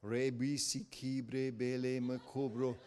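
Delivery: quietly imitating speaking in tongues; mock tongues. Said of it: "And I'm lying there going".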